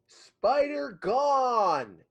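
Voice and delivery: high, long drawn-out holler; slow, high-pitched shout upward; calling up to giant. Acted. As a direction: call it way up to someone towering overhead. Player calls back up to giant.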